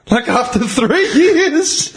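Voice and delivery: high laughing delivery; amused, slowed by laughter. Real, not acted. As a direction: laugh through the last words. Laughing at the punchline.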